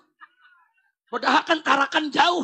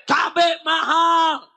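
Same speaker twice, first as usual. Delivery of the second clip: higher, drawn-out protest cry; imitating protesting mothers; 4 semitones higher, 5 dB louder, much slower than usual. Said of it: "Ibu-ibu teriak, protes pemerintah". (menirukan teriakan protes ibu-ibu)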